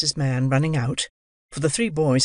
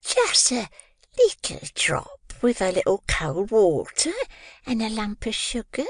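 high, slow whispered coaxing; sly whispered offer; coaxing Mrs Mann; 8 semitones higher than usual. A narrator's reading.

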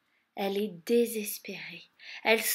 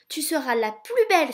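high, loud gush of admiration; ecstatic; 5 semitones higher, 10 dB louder than usual. A narrator's reading. The mice admiring Cinderella, ecstatic. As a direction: gush with delight. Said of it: "s'extasient les souris".

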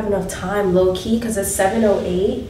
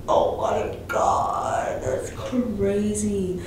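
slow, whispered disbelief; drawn-out hushed amazement; awed whisper; 4 dB quieter, much slower than usual. Real, not acted. Reacting to the bathroom, slow awed whisper.

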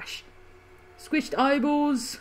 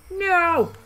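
dismayed cry; 8 semitones higher, 4 dB louder than usual. Real, not acted. His ally being killed.

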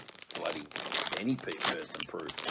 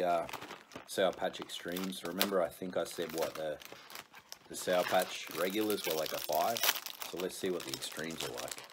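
ordinary delivery

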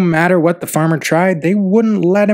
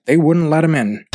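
usual speaking voice

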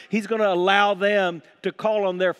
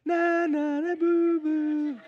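high, drawn-out singsong taunt; childish mocking; 9 semitones higher, much slower than usual. Elijah taunting the false prophets, childish singsong mockery. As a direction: tease like a kid in a playground chant. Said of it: "Elijah began mocking them".

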